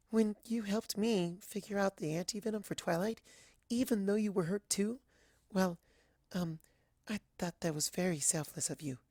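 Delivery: high, soft and hesitant; a shy pony speaking barely above a murmur; Fluttershy's timid voice. Acted.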